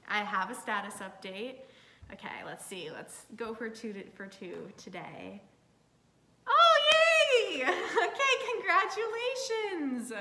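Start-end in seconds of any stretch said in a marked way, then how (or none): 6.5-10.1 much higher, very loud squeal of delight; real elated squeal; joyful shriek. Real, not acted. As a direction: squeal and laugh with joy. Accepted again.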